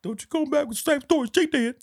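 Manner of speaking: very high-pitched, hammed-up warning; exaggerated mock scolding. Repeating [name]'s warning for laughs, squeaky mock scolding. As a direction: squeal the telling-off as a silly imitation.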